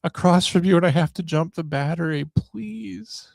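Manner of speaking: high-pitched imitation of a tearful woman begging; tearful pleading. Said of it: "she's got tears in her eyes".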